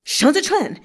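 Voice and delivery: betrayed commander snapping at his subordinate; angry snap. Acted. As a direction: snap the name in fury. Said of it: "he snapped".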